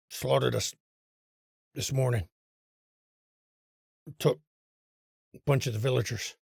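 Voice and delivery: low, quiet, gurgling creature voice; Blue Water's gurgling voice. Acted. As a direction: speak like a drowned thing from the deep. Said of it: "Blue Water goes".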